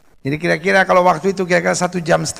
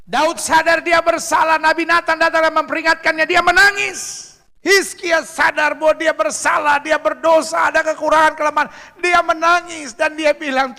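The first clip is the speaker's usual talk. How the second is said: forceful preaching; 10 semitones higher, 4 dB louder than usual. Real, not acted. Preacher recounting kings who wept over sin, forceful preaching. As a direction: preach it out high, stretching the phrases.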